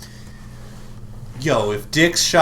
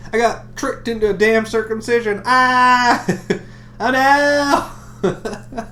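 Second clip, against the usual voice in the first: mock outrage; 11 semitones higher, 6 dB louder than usual. Mock outrage high loud.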